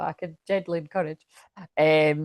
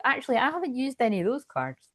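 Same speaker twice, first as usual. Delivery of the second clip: normal talking voice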